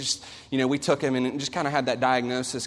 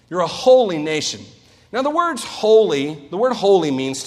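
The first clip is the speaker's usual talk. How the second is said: preaching at full force; 4 semitones higher, 6 dB louder than usual. Acted.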